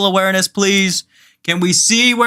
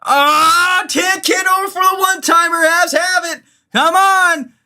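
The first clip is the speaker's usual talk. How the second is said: agitated live call; 10 semitones higher than usual. Real, not acted. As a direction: call it all worked up and frustrated. Heated live call, frustration.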